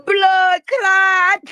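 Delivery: loud, high Jamaican exclamation; Jamaican accent. Pretend. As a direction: shout it in a mock Jamaican accent. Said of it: "It done gave me an accent".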